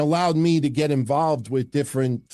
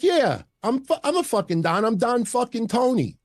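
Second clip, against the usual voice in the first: much higher, heated comeback; a little mad; 10 semitones higher than usual. Retold or imitated. Answering the bystander's Don jab, higher and heated. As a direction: proudly fire back, pitched way up. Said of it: "And I got a little mad".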